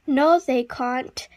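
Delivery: ordinary delivery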